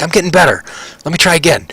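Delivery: put-on high-pitched, undaunted child voice; excited young halfling voice; eager little boy. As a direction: pipe up like a kid determined to retry. Boy insists after falling over.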